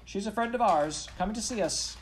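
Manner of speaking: high, loud calling over a crowd; acted boy's urgent shout; urgent cry. A narrator's reading. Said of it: "he cried".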